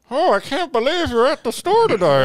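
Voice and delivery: mocking a friend's one-voice impression; same voice for everybody. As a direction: squeak it out like a cartoon. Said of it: "Same voice for everybody".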